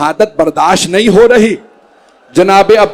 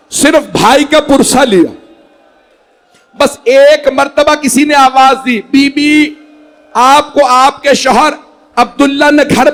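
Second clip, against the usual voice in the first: impassioned majlis recitation; 6 semitones higher than usual. Acted. Preacher driving the mourners toward grief, impassioned. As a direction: push the mourning story toward its peak.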